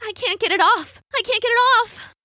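high frantic cries, rising; high-pitched heroine panic; panicked Rapunzel. A narrator's reading. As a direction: cry out in mounting desperation. Rapunzel tugs at the rope.

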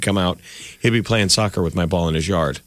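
ordinary talk

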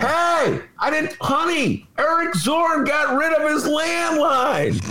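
high shout to another room; calling out. Real, not acted. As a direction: yell it across the house. Shouting to his wife in another room.

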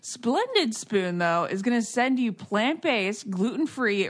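loud upbeat sponsor read. Acted. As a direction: sell it like a podcast ad. Host reads sponsor ad.

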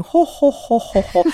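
high staccato "ho ho ho"; warm mom laugh. Retold or imitated. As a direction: mimic mom's careful wrinkle-free laugh. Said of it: "That's how my mom laughs".

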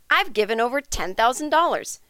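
ordinary delivery

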